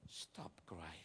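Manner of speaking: "Stop cry" said low and soft; a low, quiet order on "Stop cry"; exclamation-mark command. Shown as a demonstration. Preacher showing how Jesus said it, quiet command. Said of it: "Jadi artinya begini, tanda seru gitu".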